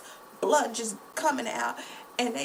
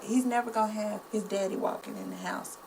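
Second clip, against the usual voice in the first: usual speaking voice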